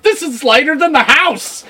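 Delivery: high, easy boastful voice; strongwoman boast. Retold or imitated. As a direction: brush it off as nothing. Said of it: "It's like".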